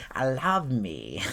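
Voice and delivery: hammed-up, self-adoring German accent; self-loving German. Performer replying to adoring learners, self-satisfied German accent. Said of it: "And I'm like".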